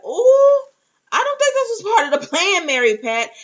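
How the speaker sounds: high, loud, rushed squeal of alarm; shocked outburst. Retold or imitated. Fan reacting to the episode's twist, high shocked squeal. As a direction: gasp it out in disbelief at the twist. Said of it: "and I was like".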